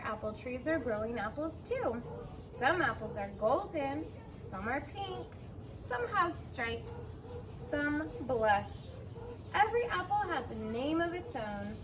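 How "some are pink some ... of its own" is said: ordinary delivery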